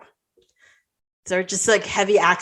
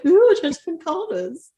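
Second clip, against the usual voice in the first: higher, cooing mock thrill; mock delight; 8 semitones higher than usual. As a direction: swoop up on an intrigued ooh. Acts out delight at the callers.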